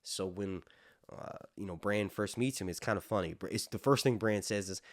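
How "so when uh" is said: ordinary talk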